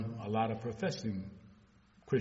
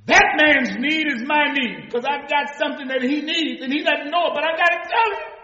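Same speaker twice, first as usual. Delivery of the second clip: preaching at full force; 16 dB louder than usual. Acted.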